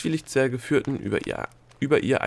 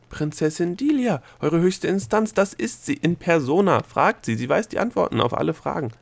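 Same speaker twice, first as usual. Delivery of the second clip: higher, louder excited gnome; agitated insistence; 7 semitones higher, 4 dB louder than usual. Acted. Gnome pointing out Delia to the official, agitated. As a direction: proclaim it urgently, pitched up.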